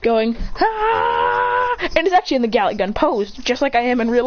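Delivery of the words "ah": long high mock battle cry; drawn-out charging "ah"; power-up yell. Clone charging a Rasengan, high yell.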